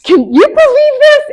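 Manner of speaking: high, loud, disbelieving cry; exasperated outburst. Retold or imitated. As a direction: blow off steam in outrage. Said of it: "I get emotional going".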